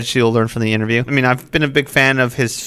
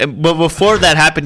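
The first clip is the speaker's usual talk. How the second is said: ordinary talk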